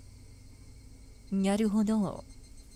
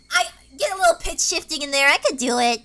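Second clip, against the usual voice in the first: ordinary talk, nothing marked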